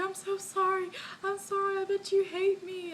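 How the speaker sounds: high, wailing mock apology; imitated emotional crying. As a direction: beg forgiveness in a re-enacted sob. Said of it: "I was being all emotional".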